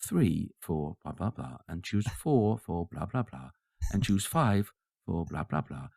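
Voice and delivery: slow mock phone-menu recording; unhurried, faux-friendly hold-line voice; automated voice message. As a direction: lightly imitate a drawn-out menu announcement. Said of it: "the automated voice message".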